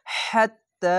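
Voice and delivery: হাত্তা with breath pushed out and the throat squeezed; throaty ha. Shown as a demonstration. Teacher shows the heavy throat ha to students. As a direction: push air through a tight throat on হাত্তা. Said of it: "বাতাস বের করে একটু মোটা করে গলা চিপে".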